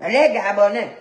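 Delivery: emphatic mimicry of Beninese French; mocking Beninese accent. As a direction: put on a Beninese accent to mock it. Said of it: "Vous avez entendu leur accent".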